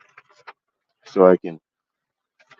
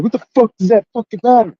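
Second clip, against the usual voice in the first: much higher, furious shout of "fucking matter"; angry yell; 14 semitones higher, 3 dB louder than usual. Retold or imitated. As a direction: yell it at the clerk, really loud in the store. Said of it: "I yelled and cussed at him".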